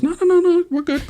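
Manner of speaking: high-pitched hurried no no no; reassuring officer. Retold or imitated. Officer reassuring the nervous confessor, high-pitched. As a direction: wave off his worry in a high voice.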